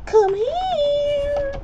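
high, drawn-out squeal on "here"; playful taunting call. Pretend. Player chasing an opponent, playful high call. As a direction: call out very high, stretching the last word.

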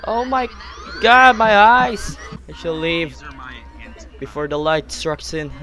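ordinary talk

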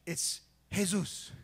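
Jesus said the Spanish way as hezus; soft h sound, hey-SOOS; Jesus pronounced hezus, quiet and slow. Shown as a demonstration. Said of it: "In, in Spanish".

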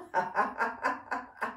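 breathy rapid staccato giggle; ticklish laugh. Pretend. Teacher swapping hands in warm-up, pretend ticklish giggle. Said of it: "imagine it's quite ticklish".

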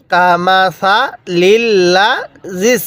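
কামাসালিল লাযিস chanted slowly with জাল tied to সিন; teacher shows correct joining of জাল; joined recitation. Shown as a demonstration. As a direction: linger on লাযিস, joining the letters. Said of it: "জালকে সিনের সাথে লাগাবেন".

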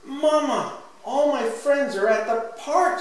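high, loud storybook squeal of news; excited child's scream. A narrator's reading. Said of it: "screams Sandy".

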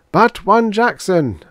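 high, slow chanted answer; assembly's response. A narrator's reading. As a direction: answer as a congregation in a litany. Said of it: "answered the assembly".

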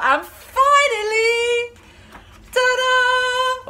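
high, slow, showy fanfare; storyteller's big ta-da reveal; grand reveal. A narrator's reading. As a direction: announce it like a magic trick.

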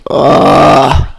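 loud, drawn-out ugh; exasperated wake-up groan; retold groan. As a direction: groan loudly at the alarm.

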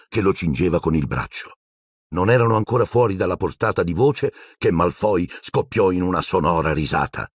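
ordinary delivery

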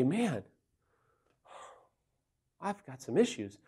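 soft, subdued admission to himself; quiet self-admission. Acted. Man turning inward about his own issues, quietly admitting.

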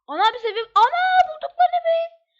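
high, loud joyful yell; excited shout. Real, not acted. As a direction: shout it out, thrilled to find home. Finding the house in game.

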